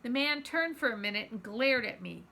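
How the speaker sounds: ordinary delivery, nothing marked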